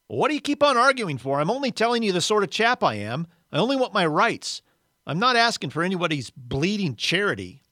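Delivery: loud, high-pitched, argumentative outburst; belligerent, aggrieved character voice; indignant ghost. Acted. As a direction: argue back, insisting on your rights. Ghost argues with the spirit.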